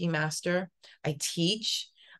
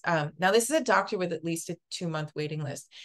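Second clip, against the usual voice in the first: ordinary talk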